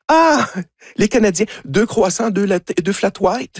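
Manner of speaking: high-pitched, imitated welcome for returning regulars; delighted greeting. Café worker greets the returning Canadian couple, delighted. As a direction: play a barista happily recognising customers.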